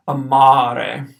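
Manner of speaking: short a then a long ah in "amare"; long second vowel. Shown as a demonstration. Teacher repeating "amare" to show the long a. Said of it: "First A short".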